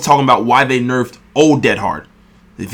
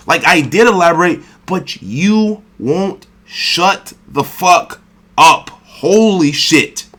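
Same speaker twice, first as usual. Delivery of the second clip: irritated; 3 semitones higher, slower than usual. Real, not acted. Streamer venting at a reactor who talks over him, irritated. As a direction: let the irritation boil over slowly. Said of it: "it's because I fucking am".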